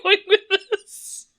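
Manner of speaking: high-pitched, drawn out through laughter; laughing disbelief. Real, not acted. Co-host reacting to a friend's odd accent story, laughing in disbelief. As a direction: laugh helplessly while asking.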